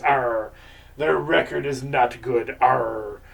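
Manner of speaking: mock pirate sportscaster. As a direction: playfully talk like a pirate, stretching the key words. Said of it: "I'm still talking in the pirate voice".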